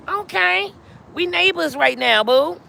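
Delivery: delighted to find a neighbor; excited greeting. Real, not acted. Greeting a viewer from Columbus.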